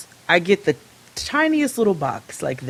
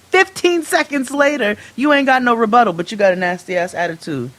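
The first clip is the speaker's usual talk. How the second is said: ordinary talk, nothing marked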